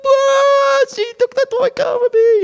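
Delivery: put-on high, loud crying child complaint; wailing child. Grandchild wails to mum about a stolen toy car, crying. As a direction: sob it out like an upset toddler. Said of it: "They go".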